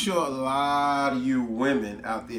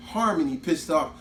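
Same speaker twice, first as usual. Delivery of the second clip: ordinary talk, nothing marked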